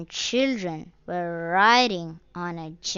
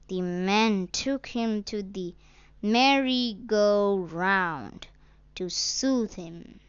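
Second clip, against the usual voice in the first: ordinary delivery, nothing marked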